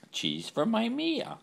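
high slow pet-voice treat; high sing-song. Real, not acted. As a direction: croon it up high to the dog.

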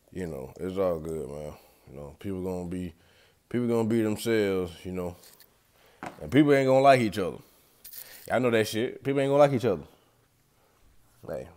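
usual speaking voice

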